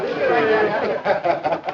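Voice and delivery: merry laugh. Acted. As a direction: laugh out with delight.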